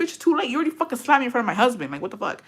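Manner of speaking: high-pitched, fast, outraged retelling; indignant retort. Retold or imitated. Daughter retells rebuffing mom after slap, indignant. Acted retort to mom's niceness.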